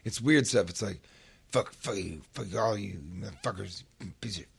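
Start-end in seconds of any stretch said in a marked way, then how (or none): 1.5-4.4 low, soft mock mumbling of a homeless man; imitating mumbling. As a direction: mutter it to yourself like a man lying on a bench. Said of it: "He's kinda mumbling to himself".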